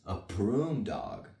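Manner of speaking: ordinary delivery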